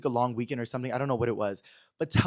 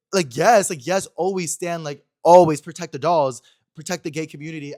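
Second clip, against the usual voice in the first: emphatic cheer; 4 semitones higher, 11 dB louder than usual. Real, not acted. Emphatic support for community.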